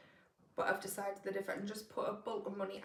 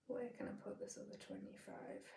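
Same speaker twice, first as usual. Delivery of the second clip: whispered; 3 semitones higher, 7 dB quieter than usual. Real, not acted. Musing to herself over numbers.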